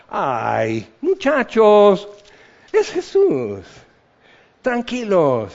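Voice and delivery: slow, complacent soothing; smug calm. Pretend. Comfortable Bible reader smugly telling the disciples to relax. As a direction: talk down to them from comfort. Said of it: "muy cómodos con nuestra Biblia".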